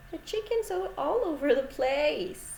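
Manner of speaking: ordinary talk, nothing marked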